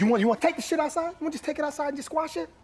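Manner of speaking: high, fast, heated challenge to fight; fired-up, daring him outside; angry challenge. Acted.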